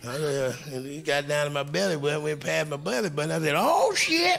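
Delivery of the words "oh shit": high, startled "oh, shit" with a laugh; mock shocked yelp. Comic telling his first road gig, startled yelp. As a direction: yelp it like you just got caught off guard. Shocked at what she's doing.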